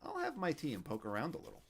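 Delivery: a grandfather helping himself; old Yiddish man. A narrator's reading. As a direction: Fischel poking around the kitchen.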